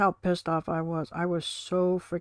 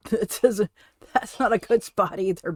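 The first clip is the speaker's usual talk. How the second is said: laughing; 4 semitones higher, 6 dB louder than usual. Real, not acted. Pet squirming, she laughs.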